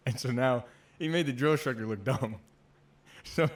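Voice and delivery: real amusement breaking into laughs; laughing. Real, not acted. Storyteller recalling the instructor made to look dumb, laughing.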